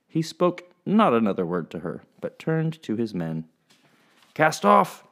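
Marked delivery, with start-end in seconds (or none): 4.3-5.1 high, loud command; captain ordering his men to sail; shouted order. Acted. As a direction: bark it to the crew.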